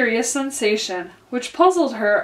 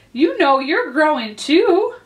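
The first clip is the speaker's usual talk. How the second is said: higher, slow, pointed retort; bolder; 5 semitones higher, 4 dB louder than usual. A narrator's reading. Alice turning it back on the Dormouse, boldly. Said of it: "said Alice more boldly".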